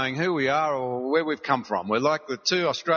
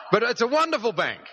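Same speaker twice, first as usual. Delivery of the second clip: eager praise; 9 semitones higher than usual. Retold or imitated. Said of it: "sorry, I shouldn't have let that out".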